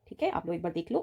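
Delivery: ordinary talk, nothing marked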